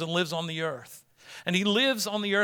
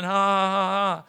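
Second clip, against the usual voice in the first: mock gloating laugh; 3 semitones higher, 3 dB louder than usual. Mocking nationalist expectation.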